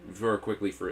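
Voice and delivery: usual speaking voice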